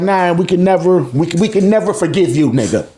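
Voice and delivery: normal talking voice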